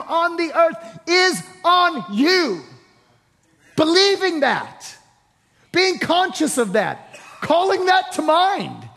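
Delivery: loud, high, shouting preacher climax; fiery revival preaching over music; preaching at full force. Real, not acted. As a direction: drive it home to the congregation.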